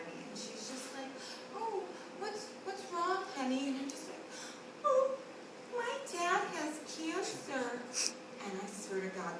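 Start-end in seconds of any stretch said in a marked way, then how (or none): 1.5-3.7 soft, higher, tender concern; concerned stranger. Acted. Woman coming by a child outside hospital room, soft concern. 4.8-7.8 soft, slow, higher mock whimper; milk it for sympathy, small and tearful; mock-pitiful child. Fourth grader pulling cancer card on kind stranger, mock-pitiful.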